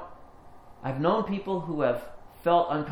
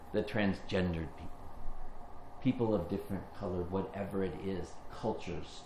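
soft reflective sermon; 4 semitones lower, 6 dB quieter than usual. Acted. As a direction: draw the congregation in gently. Quiet reflective preaching.

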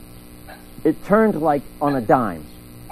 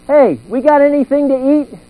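loud, much higher call for food; 9 semitones higher, 6 dB louder than usual. Retold or imitated. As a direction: shout it toward the kitchen. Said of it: "calling at the refrigerator".

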